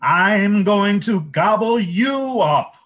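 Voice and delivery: high, loud, slow fish threat; fish threatening. A narrator's reading. River fish threatens the troll, high, loud and slow.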